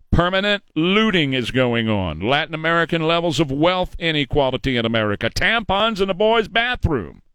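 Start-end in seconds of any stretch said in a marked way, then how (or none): none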